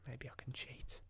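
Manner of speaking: unvoiced conspiratorial whisper; sly whisper. Real, not acted. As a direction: whisper it like a secret.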